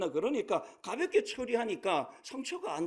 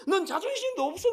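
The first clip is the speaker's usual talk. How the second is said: mock scolding; 9 semitones higher, 4 dB louder than usual. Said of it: "막 야단을 치니까".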